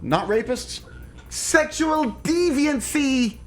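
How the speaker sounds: high, frantic shout from the car; mock warning yell. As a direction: holler a warning out the window.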